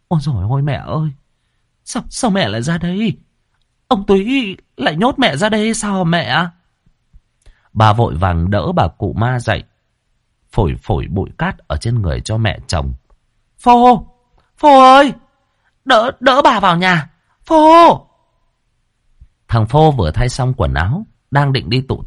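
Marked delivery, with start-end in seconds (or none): 0.1-6.5 high, alarmed cry to her mother-in-law; shocked cry. A narrator's reading. Daughter-in-law finds her mother-in-law shivering, cries out in shock. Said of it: "Bà thốt lên". 13.6-18.0 loud, high shout for her son; calling out. A narrator's reading. Mother shouts for her son to help grandma, loud and high.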